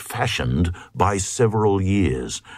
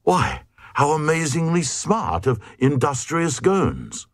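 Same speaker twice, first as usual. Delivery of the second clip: higher, admiring exclaim; praising; 7 semitones higher than usual. Acted. Glugs praising clever Gones, higher and admiring. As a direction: gush with admiration. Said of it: "Cried the Glugs praisingly".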